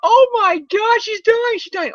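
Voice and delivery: high panicked squeal of doctors; mock panic. Doctors rushing in to Aunt May, high panicked squeal. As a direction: squeal it, frantic and overdone. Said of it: "the doctors run in like".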